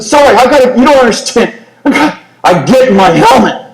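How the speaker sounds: high, urgent pleading; desperate insistence. Retold or imitated. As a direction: insist you must go. Soldier insisting to sergeant.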